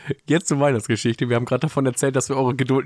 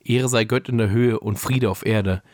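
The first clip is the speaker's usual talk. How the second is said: measured read-aloud storytelling voice; calm narrator, lower than in chat; story narration; 4 semitones lower than usual. A narrator's reading. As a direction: read the Christmas story aloud, pausing between sentences. Reads a Christmas story aloud.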